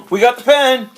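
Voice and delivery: loud, high triumphant shout; excited cheer. Real, not acted. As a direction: celebrate finding it, loudly. Excited cheer at finding pen.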